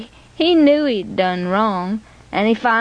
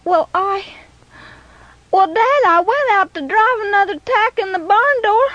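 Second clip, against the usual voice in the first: put-on a boy's voice near sobbing, much higher; tearful boy; 11 semitones higher than usual. A narrator's reading. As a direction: plead like a boy on the edge of tears. Boy confessing before sobbing.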